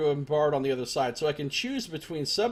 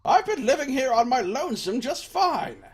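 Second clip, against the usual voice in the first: put-on high, slow, grumbling old man rant; cranky old man; 10 semitones higher, 4 dB louder, slower than usual. Gamer voicing the old villager, dismissing the soldiers. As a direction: rant like a stubborn old hermit. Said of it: "let's talk to Old Man Mua".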